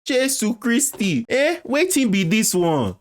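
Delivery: high-pitched Nigerian Pidgin exclamation; hammed-up Nigerian disbelief; shocked Nigerian accent. Mock shocked Nigerian Pidgin outburst.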